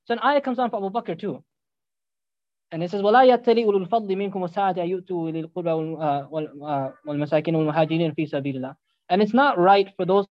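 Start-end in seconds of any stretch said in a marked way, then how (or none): 3.0-8.8 rapid recited Arabic verse, very fast; fast Quranic recitation. Acted.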